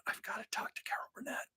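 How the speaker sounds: breathless whispered plea, fast; hushed urgent whisper; whispered blurt. Retold or imitated. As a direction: hush it out like a secret that escapes.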